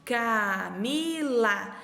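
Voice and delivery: Camila stretched out very slowly to count its sounds; slow syllable reading. Shown as a demonstration. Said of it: "três sonzinhos". (Camila bem devagar, contando as sílabas)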